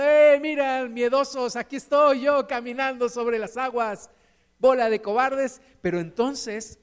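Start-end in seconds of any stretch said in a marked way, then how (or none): 0.0-5.6 loud, higher-pitched mock boasting; acted bragging call-out; cocky taunt. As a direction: show off and jeer at the cowards. Preacher voices Peter boasting to others.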